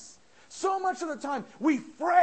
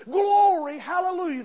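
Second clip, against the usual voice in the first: loud, higher joyful cry; shout of glory; 4 semitones higher, 7 dB louder than usual. Shown as a demonstration. Preacher shows how to praise, loud joyful shout. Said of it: "we should be shouting".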